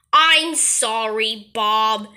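normal talking voice

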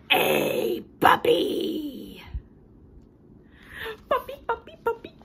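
high, thrilled squeals of puppy; overjoyed cartoon pigeon; excited pigeon. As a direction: bounce with joy over the word.

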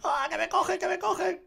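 high, quiet and panicky; mock panic. Real, not acted. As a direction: squeal that they're catching you. Panics as enemies grab him. (pánico fingido y agudo)

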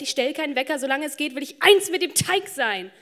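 rhythmic list swelling into an ecstatic cry; building rapture. Acted. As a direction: let the longing burst out on the last words. Climax of list of bread longings.

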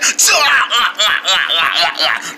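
long, stuttering cackle; drawn-out teasing guffaw; mocking laugh. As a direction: laugh hard at someone's funny voice. Mocks the new voice.

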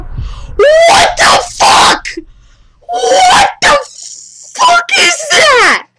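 very high, loud, drawn-out shriek; horrified scream. Real, not acted. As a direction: scream each word out slowly in shock. Jump scare in game.

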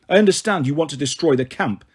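usual speaking voice

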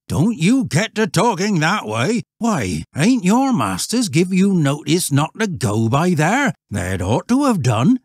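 loud, high rustic warning; alarmed old shepherd. Acted. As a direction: countryman scolding in alarm. Voices shepherd warning boys.

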